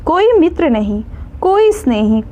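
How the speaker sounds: plain reading voice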